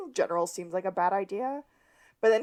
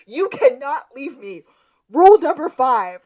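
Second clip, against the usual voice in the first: mock panic, higher and louder; panicked Gus; 7 semitones higher, 14 dB louder than usual. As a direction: insist on the rule, half-hammed panic. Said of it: "Gus is like".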